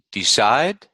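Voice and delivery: DECIDE as de-CIDE, rising on CIDE; list-like rise on the end of DECIDE; second-syllable stress. Shown as a demonstration. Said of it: "the second syllable is stressed".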